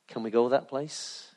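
gentle voice of God. Retold or imitated. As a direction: coax them quietly, slowly. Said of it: "he says".

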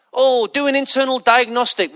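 usual speaking voice

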